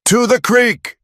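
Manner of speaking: very high, loud yell. Acted. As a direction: yell the escape route across the chaos. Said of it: "Colin yelled".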